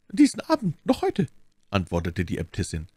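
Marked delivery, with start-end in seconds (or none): 0.1-1.3 high, ready promise from an abbess; obliging abbess. A narrator's reading. Voices the abbess replying.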